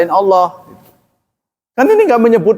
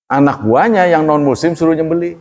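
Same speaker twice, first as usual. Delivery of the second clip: ordinary talk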